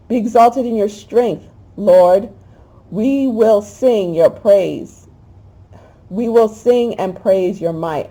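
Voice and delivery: slow, swelling praise with a catch of feeling; emotional psalm reading. Acted. Preacher praying the psalm aloud, moved, slow and swelling. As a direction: lift the words up to the Lord.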